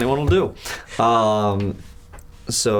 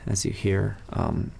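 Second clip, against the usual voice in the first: ordinary talk, nothing marked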